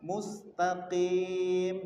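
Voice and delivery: mustaqim drawn out very slowly; slow recitation. Shown as a demonstration. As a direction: stretch each sound of mustaqim. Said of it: "Seperti kemarin kita sampaikan".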